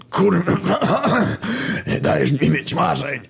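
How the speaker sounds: high, strained, broken last words; dying exasperation. A narrator's reading. Dying Kamil reproaching his friend, strained high gasps. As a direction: choke out a final complaint.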